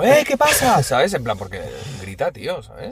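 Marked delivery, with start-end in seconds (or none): 0.0-0.8 high mock call up to the neighbour; raised half-shout through the window; calling out at the yelling neighbour, pitched high; retold call out. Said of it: "alguna vez he dicho".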